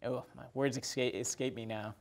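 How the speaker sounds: normal talking voice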